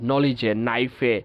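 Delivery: ordinary talk, nothing marked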